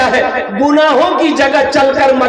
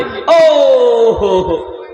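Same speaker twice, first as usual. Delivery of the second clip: excited exclamation; much slower than usual. Real, not acted. Exclaims at the reward.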